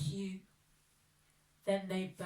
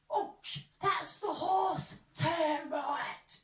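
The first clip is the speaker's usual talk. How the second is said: robber voice; 5 semitones higher, 6 dB louder than usual. Acted. Robber reacts.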